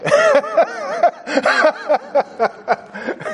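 a burst of high real laughter; delighted laugh at the student's answer; hearty laugh. Real, not acted.

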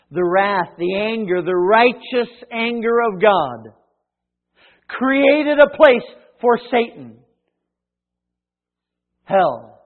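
loud, slow, rising proclamation; forceful preaching. Real, not acted. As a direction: build the force phrase by phrase.

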